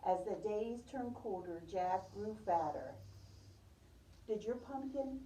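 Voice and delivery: ordinary talk, nothing marked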